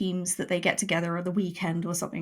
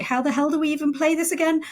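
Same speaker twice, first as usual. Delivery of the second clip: exasperated; 8 semitones higher, faster than usual. Retold or imitated.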